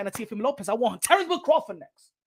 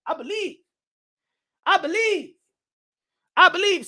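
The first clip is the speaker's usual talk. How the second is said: building conviction; 5 semitones higher than usual. Acted. Repeats belief, growing stronger.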